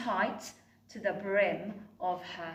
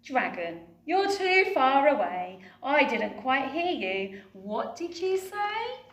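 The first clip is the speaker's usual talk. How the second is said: calling up; 4 semitones higher, 5 dB louder than usual. Acted. Betty calling up to dragon.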